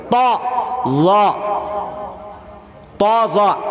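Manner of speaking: thick, full-mouthed "طا" and "ظا"; heavy articulation. Shown as a demonstration. Showing letters read thickly, heavy "طا، ظا". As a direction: make "طا، ظا" heavy, not thin. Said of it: "ایک موٹا کر کے پڑھتے".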